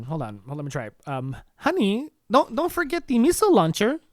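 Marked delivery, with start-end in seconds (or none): 1.6-4.0 lightly voiced Melania, pitched higher, sweetly reminding; a fond wife's gentle nag, a bit higher; wife reminding husband to pack the missile launcher, lightly higher and sweet; Melania impression. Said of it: "I have to try and do my Melania impression".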